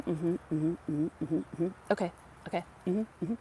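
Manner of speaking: just wait him out; bored humouring. Retold or imitated. Office worker humours a ranting caller, bored mm-hmms.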